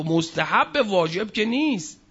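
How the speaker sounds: ordinary talk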